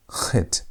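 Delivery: "chet" begun like the end of Bach; guttural CH. Shown as a demonstration. Teacher links Bach to the letter, guttural CH. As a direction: say "chet" with throat friction first. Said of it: "The C H sound".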